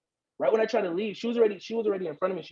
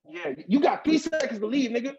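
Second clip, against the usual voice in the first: higher, menacing warning; imitating a threat; 7 semitones higher than usual. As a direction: count him down. Gunman threatening.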